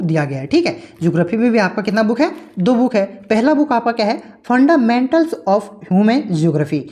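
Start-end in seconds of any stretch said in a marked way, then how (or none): 4.4-6.8 slow dictation. Shown as a demonstration. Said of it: "dictating book title slowly".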